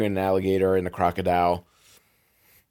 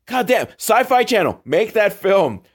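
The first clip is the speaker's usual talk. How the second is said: thrilled call-out, much higher and louder; excited; 9 semitones higher, 4 dB louder than usual. Real, not acted. Viewer excitedly urging the Sci-Fi Channel, thrilled call-out. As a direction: shout your demand with glee.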